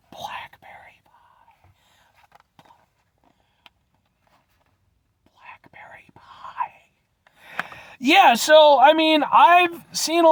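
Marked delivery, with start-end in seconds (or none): 0.1-1.1 breathy whisper of blackberry pie. Acted. Whispering the pie's name to viewers. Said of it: "is because it's a blackberry pie". 5.3-6.7 breathy reverent whisper. Acted. Whispering the pie's name to viewers in awe.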